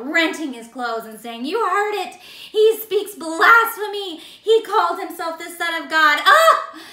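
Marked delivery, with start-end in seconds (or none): 1.5-6.8 drama llama. Acted. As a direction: overplay the scandal like a drama llama. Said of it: "He was acting like a drama llama".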